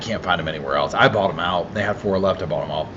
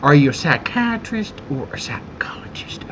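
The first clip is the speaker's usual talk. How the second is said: a hint of a courtroom attorney drawing it out; Owens voice; 5 semitones higher than usual. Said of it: "for the voice of Owens, I talk like this".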